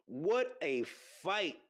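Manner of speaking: normal talking voice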